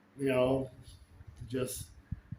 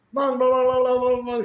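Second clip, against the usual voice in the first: high, loud babble mimicking a foreign language; mock gibberish; 9 semitones higher, 7 dB louder than usual. Husband mocks his own chatter to his wife: high, loud playful babble. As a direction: rattle off nonsense syllables playfully, pitched up. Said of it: "and I'd be going".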